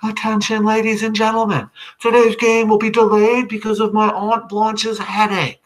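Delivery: high, loud through cupped-hand megaphone; boy mock-announcing to an imaginary crowd through his hands; stadium announcement. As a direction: announce it to the grandstand. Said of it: "announces to the grandstand".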